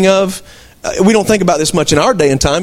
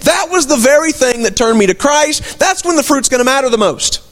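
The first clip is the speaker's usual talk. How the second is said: raised preaching climax; 5 semitones higher than usual. Acted. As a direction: lift it like a sermon peak. Pastor preaching at full force.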